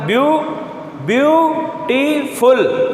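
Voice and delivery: Beautiful high and very slow, restarted on a long view; syllable-by-syllable. Shown as a demonstration. Teacher showing the force in each syllable, syllable-by-syllable. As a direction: push out view, टी, फुल one by one. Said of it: "फिर भी आपको रुकावट या ताकत".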